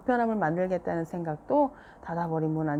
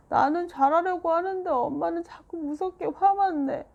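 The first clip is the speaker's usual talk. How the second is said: put-on much higher, louder tearful child; tearful complaint; 11 semitones higher, 5 dB louder than usual. A narrator's reading. Seoyeon complaining to dad about mom, tearful. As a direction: plead through tears.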